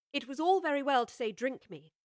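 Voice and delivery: ordinary delivery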